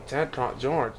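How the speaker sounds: plain reading voice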